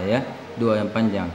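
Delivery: ordinary talk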